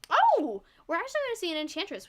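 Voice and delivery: sudden high loud fast exclamation; surprised. Real, not acted. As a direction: blurt out the surprise pick. Surprise pick announced live.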